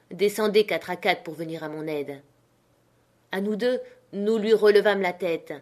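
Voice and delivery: ordinary delivery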